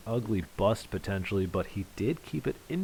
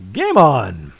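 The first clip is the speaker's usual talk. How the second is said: loud announcer shout with falling pitch; big drawn-out caller's cry; game-on call; 12 dB louder than usual. Real, not acted. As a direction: holler it to start the leg. Announcer starts the final leg.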